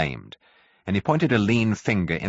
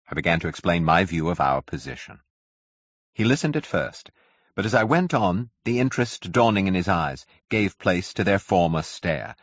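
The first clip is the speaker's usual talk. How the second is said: plain reading voice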